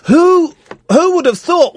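high squeaky mock astonishment; mock surprise.

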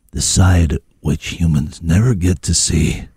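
low, slow, portentous delivery; late-night radio mystery voice. Pretend. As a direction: draw it out low like a spooky radio host.

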